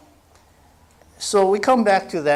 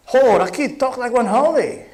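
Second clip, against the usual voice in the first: loud, high Hawaiian Pidgin exclamation; Pidgin-voiced father; 11 semitones higher, 7 dB louder than usual. A father teasing his son, loud high Pidgin jab. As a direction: belt it out like a local dad in Pidgin. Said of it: "Darrell Lum's father".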